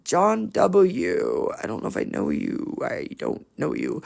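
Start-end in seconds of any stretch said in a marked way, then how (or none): 1.6-4.0 soft unvoiced aside, barely audible; muttered to herself. Real, not acted. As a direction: mumble it under your breath as an aside. Reading commenter names to herself.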